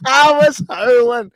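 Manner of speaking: high, drawn-out words through laughter; laughing. Real, not acted. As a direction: still cracking up while telling it. Laughing while recalling a funny scene.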